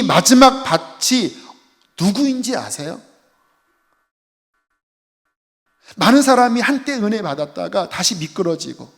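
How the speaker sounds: emphatic, rising sermon delivery; forceful preaching. Acted. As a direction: press each phrase home like a preacher.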